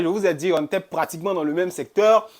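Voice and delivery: normal talking voice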